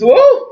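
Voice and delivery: high, loud overwhelmed whoo; emotional woo. Real, not acted. Recapping a sad episode to viewers, a high, loud emotional woo. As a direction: let the feeling burst out in a high, loud woo. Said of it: "this one just pulled on my heart".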